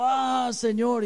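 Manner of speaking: high, loud, drawn-out wail; hammed-up anguished cry. Pastor crying to God after he fails, hammed-up wail. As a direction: cry out slowly to heaven in mock despair. Acts out own cry on failing.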